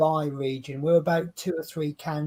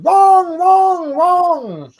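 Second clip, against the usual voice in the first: high, loud drawn-out heckle at the film; mock outraged shout; 14 semitones higher, 13 dB louder, much slower than usual. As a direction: wail each word long, high and loud in mock protest. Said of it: "I'm watching this film going".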